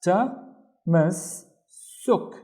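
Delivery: TA, pause, MAS, pause, SUK; three separate beats for "ta, mas, suk"; syllable by syllable. Shown as a demonstration. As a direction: sound out "ta, mas, suk" with gaps. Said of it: "once with this syllable".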